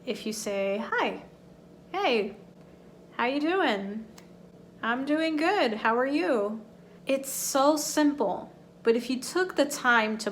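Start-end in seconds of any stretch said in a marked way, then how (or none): 0.9-6.6 bright, rising casual greeting exchange; natural greeting tune. Shown as a demonstration. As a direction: model the question intonation. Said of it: "How do we say a question?".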